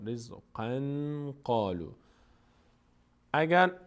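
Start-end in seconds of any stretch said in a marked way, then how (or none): 0.1-1.9 slow رزقا قالوا with nasal n linking them; joined recitation. Shown as a demonstration. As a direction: carry رزقا into قالوا as rizqan qalu. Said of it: "اینجا رزقا قالوا".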